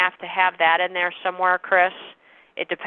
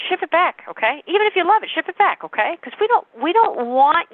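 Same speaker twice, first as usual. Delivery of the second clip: high, bright reassurance, pitch jumps up; friendly reassuring pitch; 8 semitones higher, 3 dB louder than usual. Shown as a demonstration. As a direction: lift the voice up high to soothe the buyer. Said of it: "I don't say it like that".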